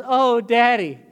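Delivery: put-on loud, high shaken little boy; hurt child. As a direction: cry out like a fallen kid. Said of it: "he says".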